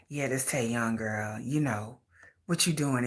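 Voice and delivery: low whispered sly reply; low mock whisper. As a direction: whisper low like a sneaky caller. Man whispering back.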